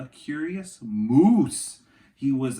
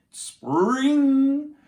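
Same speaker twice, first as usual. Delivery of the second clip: boing; 12 semitones higher, 7 dB louder than usual. A narrator's reading. Sound word acted out.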